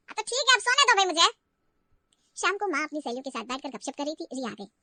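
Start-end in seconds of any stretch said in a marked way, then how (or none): 0.1-1.3 loud, high fed-up plea; mother-in-law pestered again during her nap; exasperated. Acted. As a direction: beg to be left alone, exasperated. Mother-in-law fed up, wants sleep.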